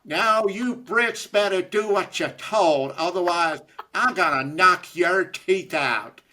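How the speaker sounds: a Brit hamming up a slow Southern drawl; Lindsey Graham impression. British guest mocks Lindsey Graham in slow, high, loud Southern drawl. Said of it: "greatest Lindsey Graham impression".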